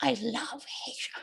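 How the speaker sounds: soft unvoiced whisper. Puppet telling the kids a secret, whispered. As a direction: breathe it like a secret.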